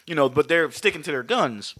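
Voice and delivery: usual speaking voice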